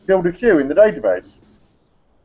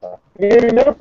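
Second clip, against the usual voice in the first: glitching audio; 4 semitones higher, 4 dB louder than usual. Real, not acted. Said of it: "Not very well".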